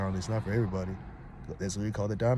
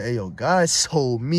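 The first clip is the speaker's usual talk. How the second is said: mock Moses announcing; 2 semitones higher, 9 dB louder, much slower than usual. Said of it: "he literally said".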